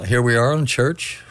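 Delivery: ordinary delivery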